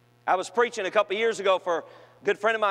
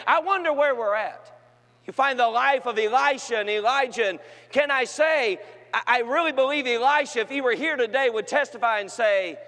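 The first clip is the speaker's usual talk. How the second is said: a touch higher and louder sermon voice; preacher building the point; steady preaching; 6 semitones higher, 3 dB louder than usual. Acted. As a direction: keep the pulpit energy going.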